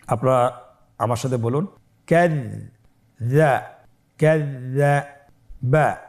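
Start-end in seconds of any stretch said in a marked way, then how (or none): 2.1-3.6 very slow, broken into held syllables; syllables separated. Shown as a demonstration. Teacher leads learners without spelling, slow separated syllables. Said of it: "পৃথক করে আমরা উচ্চারণ করব". 4.2-6.0 কাযযাবা very slow, with a gap before বা; syllables separated. Shown as a demonstration. Teacher leads learners without spelling, slow separated syllables. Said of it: "পৃথক করে আমরা উচ্চারণ করব".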